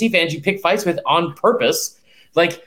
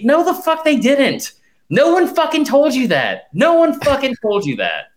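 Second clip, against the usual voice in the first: emphatic rant; 7 semitones higher than usual. Real, not acted. Ranting at fans.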